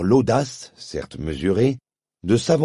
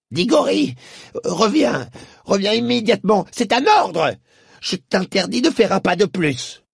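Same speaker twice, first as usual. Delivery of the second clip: put-on high shrill calls of a frightened old uncle; shouting, trembling; 11 semitones higher, 3 dB louder than usual. A narrator's reading. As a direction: yell after the boy from a safe distance. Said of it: "tremblant dans ses bottines et criant". (oncle Andrew criant, aigu et apeuré)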